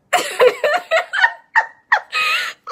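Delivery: high loud cackle; shrieking laugh. Real, not acted. Laughing at his reaction.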